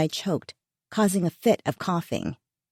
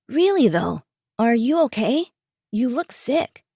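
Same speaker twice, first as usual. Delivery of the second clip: put-on teen son voice; 6 semitones higher than usual.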